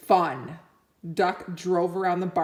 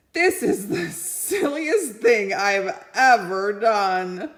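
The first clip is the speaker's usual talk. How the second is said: cow voice; 2 semitones higher, 4 dB louder, slower than usual. Acted. Cow's thought voiced.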